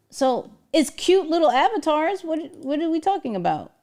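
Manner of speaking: ordinary delivery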